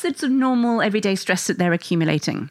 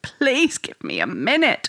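pleading; 5 semitones higher than usual. Retold or imitated. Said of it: "try and plead with them".

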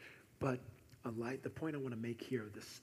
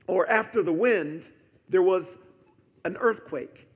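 loud, raised preacher's delivery; forceful scripture preaching; 5 semitones higher, 15 dB louder than usual. Acted. Preacher proclaiming earthquake and fire to the church, forceful scripture preaching. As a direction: proclaim each event with force.

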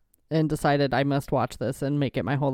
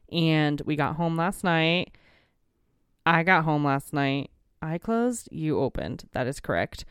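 ordinary talk, nothing marked

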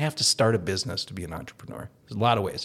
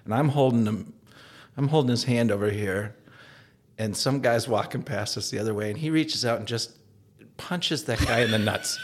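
ordinary talk, nothing marked

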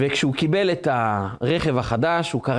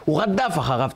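normal talking voice

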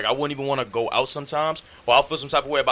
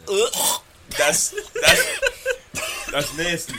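laughing in disgust; 7 semitones higher, much slower than usual. Real, not acted. Grossed out by pimple story.

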